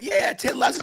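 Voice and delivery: very high mock cheer; crowd cheer. Fans cheering him as he walks off, very high. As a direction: call it out like a fan.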